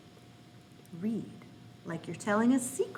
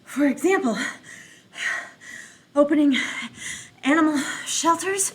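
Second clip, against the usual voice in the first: louder, gasping, winded reading; out of breath; 3 semitones higher, 5 dB louder, faster than usual. Shown as a demonstration. Teacher reading as if she just ran a marathon, winded. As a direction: push the words out like after a marathon. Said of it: "as if I just finished running a marathon".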